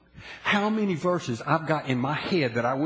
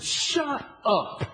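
higher, curt command to himself; firm shut up; 7 semitones higher than usual. Retold or imitated. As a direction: shut his own complaints down. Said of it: "I said".

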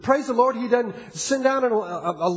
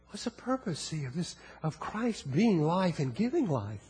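quiet altar call; 6 semitones lower, 9 dB quieter than usual. Acted. Preacher softly appeals to congregation over music, hushed. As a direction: draw them in, low and intimate.

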